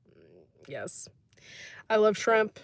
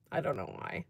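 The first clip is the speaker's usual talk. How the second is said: soft private whisper; 2 semitones lower, 6 dB quieter than usual. Real, not acted. Telling her viewers about steak and shrimp, whispering. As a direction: murmur it under your breath like a confession.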